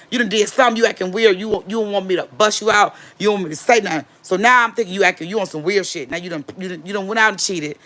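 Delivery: ordinary delivery, nothing marked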